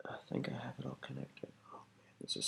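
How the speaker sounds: whispered in awe; whispering. Real, not acted. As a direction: whisper while concentrating. Murmuring to himself over delicate work.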